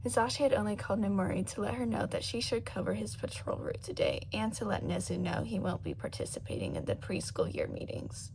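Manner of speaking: ordinary delivery, nothing marked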